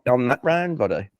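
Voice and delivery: normal talking voice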